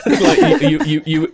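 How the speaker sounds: laughing. Real, not acted. GM cracks up mid-line.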